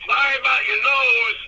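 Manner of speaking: loud, high mock apology; imitating Doctor D. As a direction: holler the jab down the phone. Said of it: "He goes".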